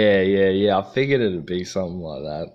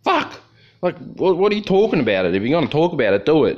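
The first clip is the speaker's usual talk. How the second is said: defiant retort; 8 semitones higher, 3 dB louder than usual. Retold or imitated. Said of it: "And I was like".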